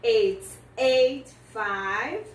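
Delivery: "It's eight five" drawn out very slowly; very slow model. Shown as a demonstration. Teacher models "It's eight five" for students, very slowly. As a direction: stretch every word of "It's eight five". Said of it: "do jeito que nós olhamos os números".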